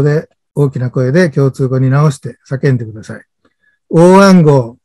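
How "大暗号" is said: loud quiz prompt to the room; calling out. Shown as a demonstration. Club leader prompts the audience to shout back, calling out. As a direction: call it out loud, drawn out. Calls dialect word for audience.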